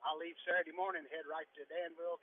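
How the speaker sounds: ordinary delivery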